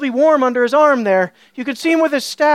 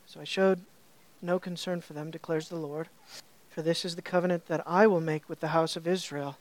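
low, much softer reading of the verses; hushed scripture reading; 7 semitones lower, 9 dB quieter than usual. Acted. Preacher reading Jeremiah aloud, hushed and reverent. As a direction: read the Lord's words as if set apart.

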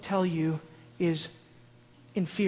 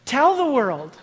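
exhortation; 5 semitones higher, 7 dB louder, slower than usual. Acted. Exhorting congregation, loud.

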